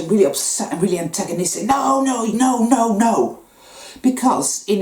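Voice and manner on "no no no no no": mock protest. The anxious, antagonistic side.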